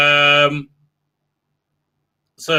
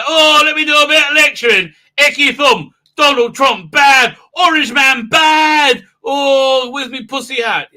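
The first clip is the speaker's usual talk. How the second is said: high, loud hammed-up lecturing woman; mocking impression; 9 semitones higher, 8 dB louder than usual. Mocking the Doctor's lecture on Trump, hammed-up shouting. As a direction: shout it like a preachy scold.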